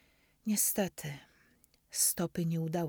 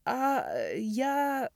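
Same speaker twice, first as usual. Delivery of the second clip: stammered; 8 semitones higher, 4 dB louder than usual. A narrator's reading. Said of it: "zająknąłem się".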